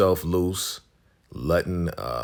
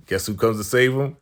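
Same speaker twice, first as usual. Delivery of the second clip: normal talking voice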